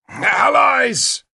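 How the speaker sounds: high, ringing shout; excited shout. Acted. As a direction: shout it out with glee. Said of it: "I heard Lancelot shout".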